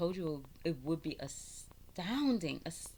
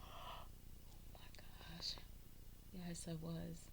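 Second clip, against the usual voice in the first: whisper; 13 dB quieter, slower than usual. Real, not acted. Gasp at her own age.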